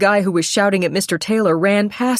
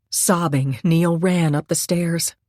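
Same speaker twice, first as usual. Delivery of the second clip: plain reading voice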